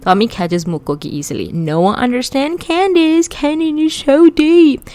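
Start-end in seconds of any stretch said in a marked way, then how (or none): 1.5-4.9 high, wailing mockery; mock weeping. Brother mocking his sister's soulful song, mock wailing. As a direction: wail it in exaggerated fake emotion, rising and drawn out. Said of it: "He's wiping away fake tears".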